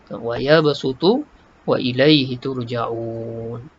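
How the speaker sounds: slow soft recitation with sin in yabsutu; recited. Shown as a demonstration. Teacher reciting the verse for students. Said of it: "baca dengan huruf sin".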